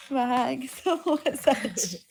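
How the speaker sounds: giggling while saying it; words broken by laughter; laughing through words. Retold or imitated. Repeats the offer, laughing. (tumatawang nagsasalita)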